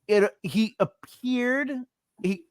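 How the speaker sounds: mock-delicate halting description; over-careful witness. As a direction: pick each word with mock caution. Improvised over-careful witness to police.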